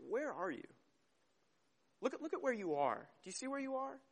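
hushed, searching questions; gentle, no iron fist. Acted. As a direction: softly coax them to see themselves. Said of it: "He doesn't come in with this heavy iron fist".

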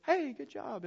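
a high, offhand "Hey, good job"; flippant. Retold or imitated. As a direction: toss it off without caring. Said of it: "in a sort of flippant way".

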